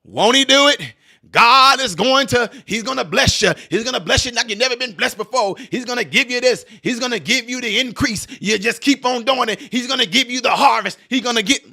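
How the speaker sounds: mock preacher promising blessings. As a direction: preach it up like a charismatic pastor. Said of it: "And what do preachers do?".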